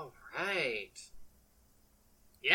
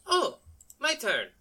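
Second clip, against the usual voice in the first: high, loud pleased "oh"; raised, playful character voice; acted surprise; 8 semitones higher, 5 dB louder, slower than usual. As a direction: pipe up as if just noticing. Voicing Jocasta taking her turn.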